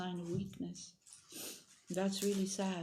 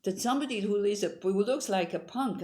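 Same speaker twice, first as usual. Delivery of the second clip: ordinary talk, nothing marked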